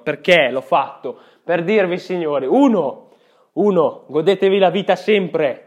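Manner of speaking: loud, emphatic pep talk; rally the listeners; motivational speech. Acted.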